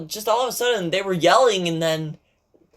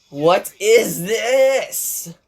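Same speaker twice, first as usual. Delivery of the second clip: very slow, louder and stretched out; menacing demand; 3 semitones higher, 5 dB louder, much slower than usual. Acted. Sakura before going Super Saiyan, slow menacing demand. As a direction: drag each word out like a threat. Sakura furious at Hina's injury.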